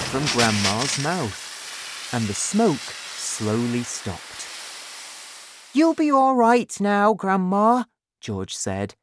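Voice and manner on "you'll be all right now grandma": put-on high, loud young boy voice; boy reassuring grandma. A narrator's reading. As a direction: reassure her brightly in a raised voice. Boy reassures grandma after rescue.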